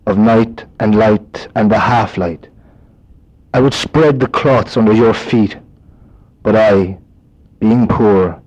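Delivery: slow, measured reading with long pauses between lines; poem recital. Acted. Reciting Yeats to the listeners in his own accent, slowly. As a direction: let each line of the poem settle before moving on.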